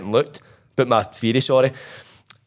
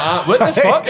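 higher, louder stunned outburst; retold shock; 7 semitones higher, 5 dB louder than usual. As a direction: blurt it out in disbelief. Opening parcel, finds MacBook.

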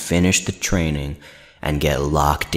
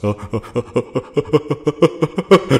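laughing; 8 semitones higher than usual. Real, not acted. Laughs at own joke.

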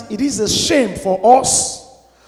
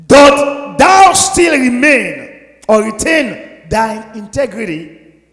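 preacher's shout; 3 semitones higher, 8 dB louder, much slower than usual. Acted. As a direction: thunder the verse out slowly. Preacher shouts the wife's words.

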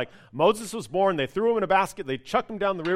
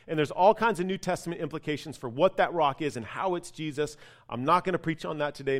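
ordinary talk

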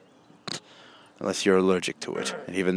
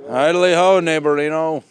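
Flanders-style greeting; 5 semitones higher, 7 dB louder than usual. Pretend. Sing-song neighbor greeting.

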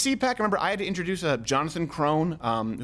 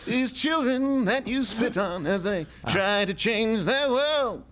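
mock activist plea; 5 semitones higher, much slower than usual. Mocking Greta-style plea.

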